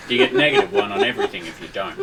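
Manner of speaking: laughing high and fast. Real, not acted. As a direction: giggle through the ruling. Laughing while giving penalty.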